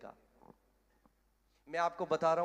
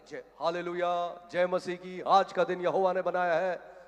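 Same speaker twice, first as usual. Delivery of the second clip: mock devout slogans; 6 dB louder than usual. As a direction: parrot pious catchphrases with big emphasis. Mimics showy churchgoers reciting slogans. (दिखावटी धार्मिक जयकारा)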